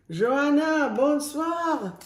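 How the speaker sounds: put-on French accent. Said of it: "do it in a French accent".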